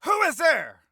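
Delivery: very high shout, dropping at the end; yelled line. Acted. Voice actor's second take of the shouted line, yelled. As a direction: yell it high as a sharp challenge. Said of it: "let's do a second take".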